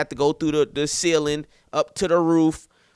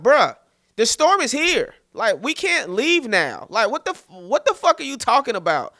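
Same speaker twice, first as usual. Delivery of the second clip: higher, raised-voice incredulous pushback; worked-up, disbelieving protest; exasperated reply; 7 semitones higher, 4 dB louder than usual. Retold or imitated. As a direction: retell it like arguing with a panicking friend. Retelling his exasperated reply to friend.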